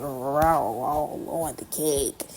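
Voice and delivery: very slow, drawn out; a hint of a playful child voice, slowed down; lightly voiced messy kid. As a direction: stretch each word like a kid smearing icing. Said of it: "took your hand and said".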